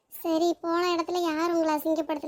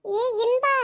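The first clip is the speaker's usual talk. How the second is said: raised, rising call to get attention; 5 semitones higher, 4 dB louder, much slower than usual. Acted. Calling to Inba down the street, raised rising call. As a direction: call the name out, higher with a rising end.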